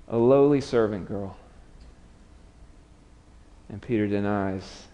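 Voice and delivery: normal talking voice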